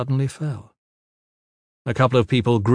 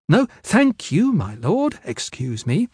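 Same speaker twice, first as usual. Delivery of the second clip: flustered polite refusal; 7 semitones higher, slower than usual. A narrator's reading. Groped woman politely refuses a lord.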